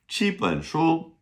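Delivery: ordinary delivery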